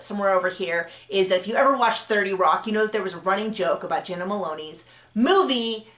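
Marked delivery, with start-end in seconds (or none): none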